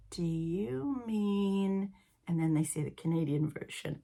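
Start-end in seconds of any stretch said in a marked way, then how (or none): 0.1-1.9 very slow, drawn-out prompting; puzzled, hesitant query. Retold or imitated. Friends gently querying her British word, drawn-out and puzzled. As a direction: imitate friends drawing out a careful question. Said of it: "they all kinda look at me like".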